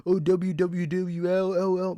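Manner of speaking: slow, drawn-out put-on fan voice; mocking hype fans. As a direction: parody a cheering fanboy. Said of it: "so many people being like".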